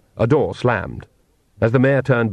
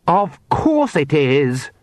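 slow, higher-pitched moan; 4 semitones higher, much slower than usual.

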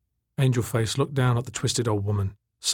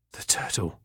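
quiet hoarse rasp; hoarse and worn out; 6 dB quieter than usual. Acted. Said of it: "Tak said hoarsely".

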